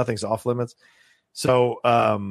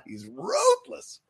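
emphatic ruthless; 3 dB quieter, much slower than usual. Real, not acted. Gushing about a ruthless character.

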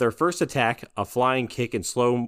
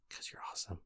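whisper; 21 dB quieter, much faster than usual. Real, not acted. Whispered reply.

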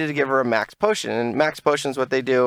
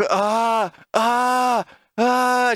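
frustrated groan; 8 semitones higher, much slower than usual. Retold or imitated. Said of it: "I was shaking".

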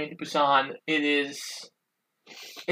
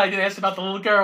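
fast, breathless worry; fast mock panic over a forgotten girl; rapid retold panic; 4 semitones higher, much faster than usual. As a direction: rattle it off in a panic. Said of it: "Oh my God".

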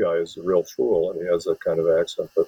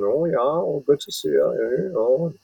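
mocking Midwestern small talk, pitched high; exaggerated Midwestern accent, high and lilting; broad Midwestern accent; 7 semitones higher than usual. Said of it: "the broad accents of the people".

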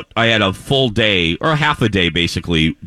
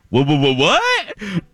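stunned disbelief; 6 semitones higher, slower than usual. Retold or imitated. Retold shock at price.

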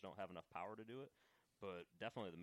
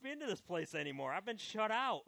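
high-pitched pretend whining; hammed-up complaint of the poor outcast; mock sulk; 9 semitones higher, 9 dB louder than usual. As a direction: play the wronged victim, pitched high. Mock complaint about being shut out.

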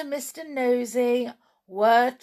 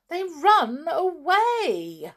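ordinary delivery, nothing marked

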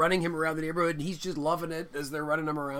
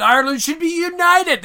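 high, loud mock political rallying cry; rousing declaration; 12 semitones higher, 12 dB louder than usual. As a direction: proclaim it like a stump speech, swelling big.

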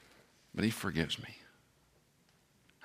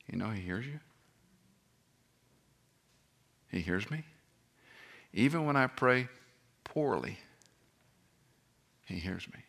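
softer, hushed and reassuring; tender assurance; 7 dB quieter than usual. Real, not acted. Preacher assuring widows and grieving people God hears them, softly. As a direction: lean in and reassure them gently.

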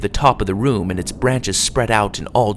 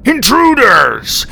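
slow, loud, high guard's cry; yelled alarm; 12 semitones higher, 7 dB louder, slower than usual. A narrator's reading. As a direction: raise the alarm across the hall. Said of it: "one of them yelled".

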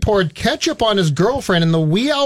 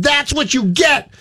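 mock angry; 7 semitones higher than usual. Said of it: "Wegman allegedly said".

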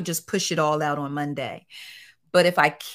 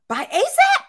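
much higher squeaky quote; mock cameraman; 7 dB louder than usual. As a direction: play the gossiping cameraman. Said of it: "cameramen talking about".

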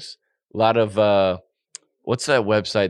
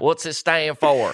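higher, slower Southern drawl; Southern accent; 4 semitones higher, slower than usual. Pretend. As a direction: ask it in a slow Southern drawl. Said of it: "We've done a Southern accent".

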